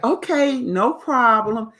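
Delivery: high, loud eager yes; excited okay to the friend. Retold or imitated. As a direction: chirp it out, all excited. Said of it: "So she's all excited".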